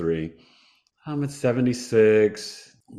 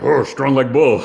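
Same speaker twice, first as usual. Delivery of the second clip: loud mock-macho boast, a touch higher; Ben Stiller impression; 4 semitones higher, 9 dB louder than usual. Joking to the host about feeling tough on the stretcher, loud mock-macho boast. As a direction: ham up a dumb strongman brag. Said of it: "thinking of, like, Ben Stiller".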